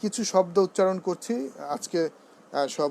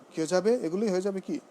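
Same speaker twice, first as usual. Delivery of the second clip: usual speaking voice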